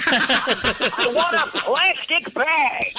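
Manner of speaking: very high, slow, screeching comic rant; Gilbert Gottfried. Retold or imitated. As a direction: screech every word, stretched out in exasperation. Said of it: "he's gotta do that fucking voice".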